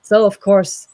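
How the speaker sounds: usual speaking voice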